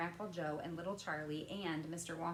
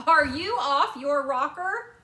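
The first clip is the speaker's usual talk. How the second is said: slow, much louder and higher indignation; incredulous candymaker; 8 semitones higher, 16 dB louder, slower than usual. A narrator's reading. Wonka scolding Mike, slow and much louder. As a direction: lean hard on each word, loud and slow.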